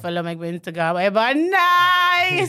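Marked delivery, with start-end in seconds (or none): 1.4-2.5 mock protest. Everyone at work knows.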